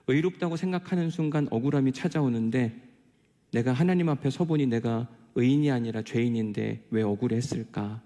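ordinary delivery

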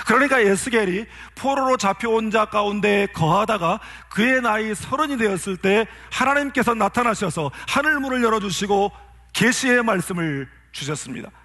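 ordinary talk, nothing marked

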